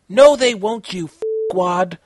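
loud snap at him; yelled insult. Acted. Konata yelling at the guy, loud and annoyed. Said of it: "Konata yelled at him".

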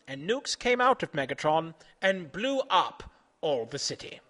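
usual speaking voice

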